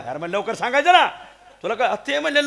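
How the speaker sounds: ordinary talk, nothing marked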